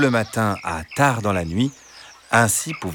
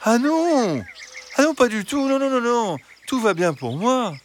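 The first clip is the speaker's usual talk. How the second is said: very high, sweet fox voice; reassuring fox. Acted. Fox telling hens all is well, reassuring. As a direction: wave the worry away, pitched way up.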